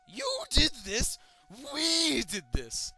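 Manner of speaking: slow, high, loud menacing taunt; the reflection accuses you slowly. Acted. Reflection answers.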